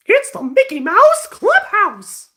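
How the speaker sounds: very high, slow, loud cartoon mouse voice; sing-song it like the clubhouse host; slow squeaky Mickey announcing the show; Mickey Mouse impression. Shown as a demonstration. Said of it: "in Mickey Mouse's voice".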